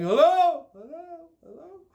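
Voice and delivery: loud call fading to a faint echo. Retold or imitated. Calling into the empty school halls, loud then fading. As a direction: call out, then let it die away like an echo.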